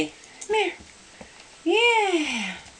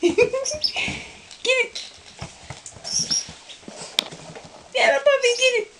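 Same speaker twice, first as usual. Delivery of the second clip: much higher squealing praise; excited puppy talk; 12 semitones higher than usual. Real, not acted. Cheering puppy at play.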